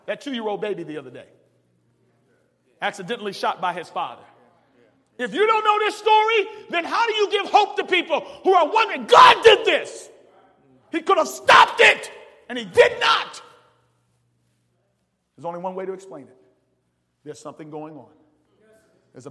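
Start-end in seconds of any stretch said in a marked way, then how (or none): 5.2-13.4 full-voiced sermon climax; preaching at full force. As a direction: lift it up and holler it to the church. Preacher builds to a shout.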